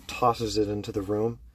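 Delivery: usual speaking voice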